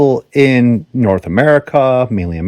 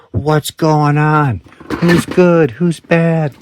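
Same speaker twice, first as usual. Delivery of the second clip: put-on childlike wonder; 6 semitones higher, much slower than usual. Kid wondering at artwork.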